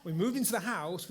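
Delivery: usual speaking voice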